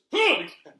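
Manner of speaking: short high, loud yelp; imitated yelp. Mic man pulled by his cord, yelping. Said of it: "he goes".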